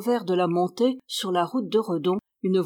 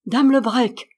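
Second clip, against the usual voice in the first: louder cry over the storm; miller's cry; 3 semitones higher, 7 dB louder than usual. A narrator's reading. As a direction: call her name out over the wind. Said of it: "s'écria le meunier".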